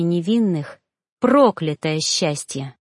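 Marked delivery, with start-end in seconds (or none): none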